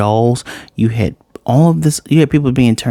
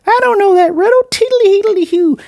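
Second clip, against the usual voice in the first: very high, silly mocking voice; mocking confused crowd. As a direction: squeak it out like a clueless moviegoer. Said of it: "everybody's like".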